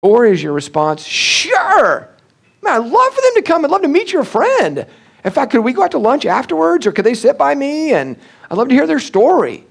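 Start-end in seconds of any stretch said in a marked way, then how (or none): 1.0-9.6 high, loud, gushing welcome; overjoyed welcome. Pretend. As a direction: greet the newcomer with delight. Eager welcoming response to visitor.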